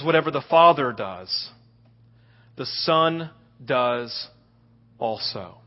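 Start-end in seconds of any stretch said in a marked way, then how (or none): none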